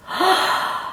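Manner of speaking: sharp high gasp; shocked gasp. Acted. Marjorie felt shocked.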